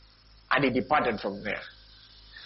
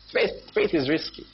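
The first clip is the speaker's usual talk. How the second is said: normal talking voice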